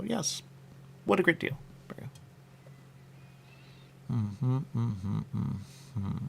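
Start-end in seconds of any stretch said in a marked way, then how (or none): 0.0-1.6 high delighted yes. Retold or imitated. Shopper delighted by deal.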